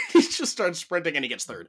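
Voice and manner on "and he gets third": ordinary talk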